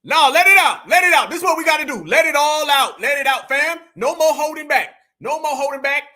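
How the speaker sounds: loud rallying shouts; pumping up the speaker; hype-man shouting. Real, not acted. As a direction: fire her up, shout it. Hype man urging her on.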